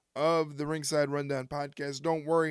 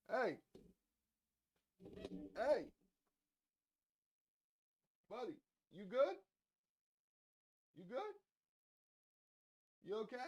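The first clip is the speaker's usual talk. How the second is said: softer, higher gentle check-ins; soft coaxing; 5 semitones higher, 13 dB quieter than usual. Real, not acted. Owner softly checking on Leroy lying oddly, gentle. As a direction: coax the pet softly.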